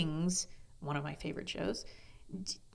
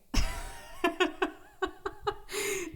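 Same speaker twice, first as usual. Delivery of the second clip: high burst of laughter; 14 semitones higher than usual. Real, not acted. Laughing at her own crying remark, a real high laugh. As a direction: cut loose with a real laugh.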